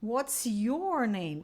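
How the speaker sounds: What's your name very slow, your as or; slow correct model. Shown as a demonstration. Teacher correcting mistake with slow model phrase. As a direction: model the right phrase slowly. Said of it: "Não".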